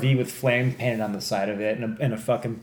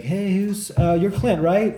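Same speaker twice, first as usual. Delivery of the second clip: higher, casual imitation of a cool cop; a hint of a laid-back cop; cool-guy detective; 8 semitones higher, slower than usual. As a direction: lightly voice an easygoing detective. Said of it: "like, the cool guy".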